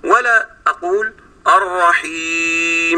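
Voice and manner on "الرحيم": الرحيم ending on a long, doubled meem. Shown as a demonstration. Teacher demonstrating the over-stressed meem. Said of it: "مشددة".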